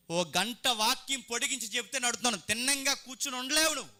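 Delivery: high-pitched, insistent scolding; exasperated scolding. Real, not acted. Preacher chiding listeners who cannot sit still, scolding. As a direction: scold them with the pitch pushed up.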